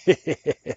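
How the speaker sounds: a sudden loud burst of laughter; real laugh. Real, not acted. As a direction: crack up at the article. Laughs reading the news, then apologizes.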